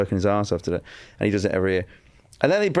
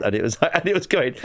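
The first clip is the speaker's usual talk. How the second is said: ordinary talk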